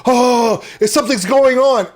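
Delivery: high, loud, exaggerated gasp of alarm; mock alarm. Mocking why the nonsensical post got picked up, mock alarmed. Mimicking overexcited reaction to viral post.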